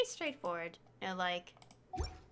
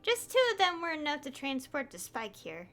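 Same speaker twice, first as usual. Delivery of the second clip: higher-pitched, measured character reading; game dialogue read-aloud; 5 semitones higher than usual. Acted. Streamer voicing an expert explaining the spike, reading aloud.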